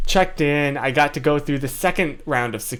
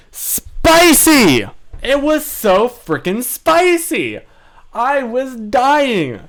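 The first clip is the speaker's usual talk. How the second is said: high, slow, loud exclaiming; excited exclaim; 7 semitones higher, 5 dB louder, slower than usual. Real, not acted. Recalling very spicy chicken.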